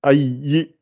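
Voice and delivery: normal talking voice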